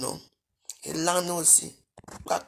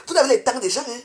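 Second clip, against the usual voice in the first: ordinary talk, nothing marked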